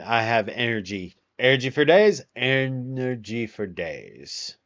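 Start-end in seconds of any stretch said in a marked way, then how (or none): 1.4-2.2 loud, punchy burst of words; vocal stimming. Real, not acted. Streamer repeating a favourite phrase as a vocal stim, loud and punchy. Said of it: "I'm vocal stemming". 2.3-4.6 slow, stretched-out echo; vocal stimming. Real, not acted. Streamer echoing their own phrase as a vocal stim, slow and drawn out. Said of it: "I'm vocal stemming".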